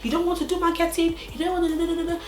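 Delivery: high, dismissive boss voice; mocking boss. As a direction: wave him off like a scornful boss. Boss mocking him, retold.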